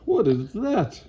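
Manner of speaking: high, swooping startled question; alarmed, high-pitched question; startled cry. A narrator's reading. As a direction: demand to know, pitch jumping up. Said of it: "cries Frank".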